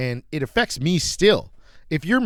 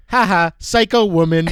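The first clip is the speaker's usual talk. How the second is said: louder, higher sing-song jeer; louder mocking taunt from the car window; 5 semitones higher, 6 dB louder than usual. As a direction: taunt her with a fake laugh. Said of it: "And I just go".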